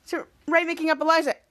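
usual speaking voice

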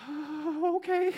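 high, surprised little "oh, okay"; startled compliance. Retold or imitated. The embarrassed man answers Jesus: high, startled agreement. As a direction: caught off guard and going along. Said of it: "And the guy's like".

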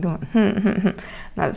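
laughing. Real, not acted. Laughs at own mishap.

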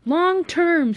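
high, loud shout; screamed retort. Acted. Friend screams back an answer, insistent. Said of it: "screamed Josh".

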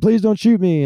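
high, loud hammed-up plea; mock pleading. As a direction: beg in exaggerated fake panic. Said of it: "I'm like".

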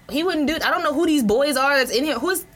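indignant protest. Retold or imitated. Sister refusing the officer's paper, indignant protest.

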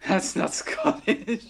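high, slow words through laughter; helpless laughing jab. Real, not acted. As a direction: tease through a real laugh. Laughing at friend's accent.

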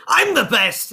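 high, boastful yell; quarrelling shout. Acted. Two smiths both turn to the stranger, quarrelling shout. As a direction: shout it over your rival.

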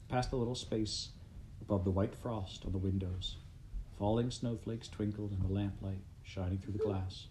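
ordinary delivery

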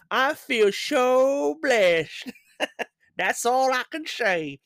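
put-on American accent. Quoting the lottery winner in an exaggerated American accent. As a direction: stretch it out in a gushing mock American voice. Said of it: "I'm gonna do it".

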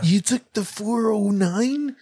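slow, high mock Californian; Californian accent. Californian asking about the freeway, exaggerated drawn-out accent. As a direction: stretch it out in hammed-up Californian.

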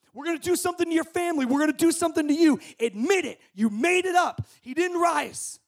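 fast, loud, high threatening rant; angry threat. Retold or imitated. Persecutor threatening a martyr, fast angry shouting. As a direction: rattle off the threats to scare him.

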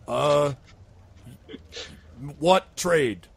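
troll mulling it over, long pause after a loud uh; stall, then ask it bluntly; thinking troll. Acted. Troll considering a trade offer, slow and puzzled. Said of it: "and he thinks about it".

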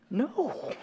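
high, soft mock-horrified no; comic, reluctant refusal; retold refusal. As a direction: draw back with a quiet, high-pitched no. Refusing the Holy Spirit's prompting.

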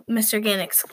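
ordinary delivery

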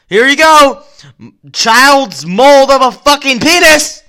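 high loud put-on voice; mock presenting shout. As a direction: hand it over with a goofy yell. Acted handing over.

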